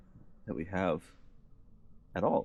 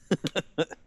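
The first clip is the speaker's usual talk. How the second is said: high giggle; 10 semitones higher than usual. Real, not acted. Laughs at own remark.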